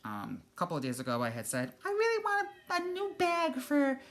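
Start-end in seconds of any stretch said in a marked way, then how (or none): 1.8-4.0 very high, wistful self-mimicry; retold wishing. Quoting her wish for a bag, high-voiced longing. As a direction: wish aloud in a high little voice.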